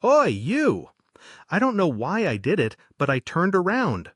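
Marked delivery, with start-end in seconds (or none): 0.0-0.9 loud, high call; shouting. Retold or imitated. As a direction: bark it at her back. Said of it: "he said".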